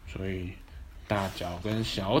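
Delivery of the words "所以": ordinary delivery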